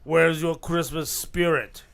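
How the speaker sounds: slow, low action-hero voice; deep drawn-out Arnold voice; Schwarzenegger impression. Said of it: "It wasn't an Australian accent".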